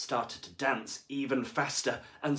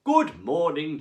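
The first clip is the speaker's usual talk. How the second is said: much louder cheerful greeting; beaming teacher; 5 semitones higher, 11 dB louder, slower than usual. A narrator's reading. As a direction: greet the class with a big smile. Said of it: "beamed the teacher".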